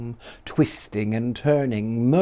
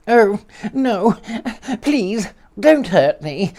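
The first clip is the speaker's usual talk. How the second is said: higher, pleading little man, slow on please; frightened plea; 8 semitones higher, slower than usual. Acted. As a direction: beg not to be hurt, voice pitched up. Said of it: "cried the little man".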